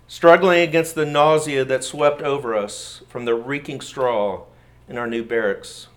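usual speaking voice

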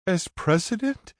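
shrill high disbelieving question; high-pitched. A narrator's reading. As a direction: pitch it way up in shock. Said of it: "Prinny's high-pitched voice echoes".

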